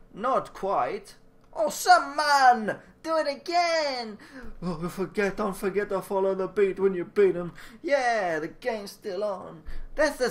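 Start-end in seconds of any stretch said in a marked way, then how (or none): 1.5-4.2 excited cheer. Acted. Cheering the dance moves.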